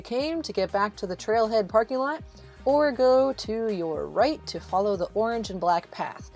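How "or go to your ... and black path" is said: normal talking voice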